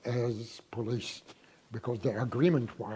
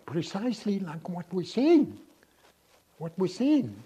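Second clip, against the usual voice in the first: higher and louder, insisting on it; excited emphasis; 8 semitones higher, 8 dB louder than usual. Real, not acted. Telling the interviewer what they witnessed, excited and insistent. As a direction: lift the voice with wonder at the sight.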